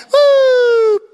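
high, loud mock police siren wail; a loud siren noise; siren imitation. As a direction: whoop like a siren.

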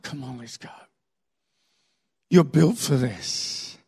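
low, hushed encouragement; gentle urging. Acted. God urging a weary believer onward, gentle. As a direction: coax softly, slowing at the end.

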